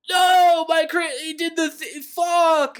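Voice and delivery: high, loud broken howl; hammed-up howl of despair; anguished cry. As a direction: cry out in horror, stumbling. Victor finding Clerval murdered.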